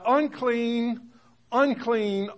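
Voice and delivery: high, loud cry of unclean; leper's cry. Acted. The leper warns people away, crying out. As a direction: call out unclean as a warning. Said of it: "and shall cry".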